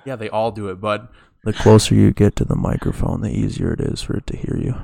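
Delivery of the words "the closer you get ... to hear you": loud, right up on the mic; close to the mic. Real, not acted. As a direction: lean into the mic and boom. Leaning into the microphone.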